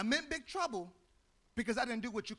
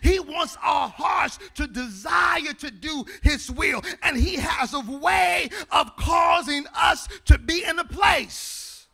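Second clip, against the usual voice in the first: full-force preaching; 3 semitones higher, 12 dB louder than usual. Acted. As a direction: build the call up to the congregation.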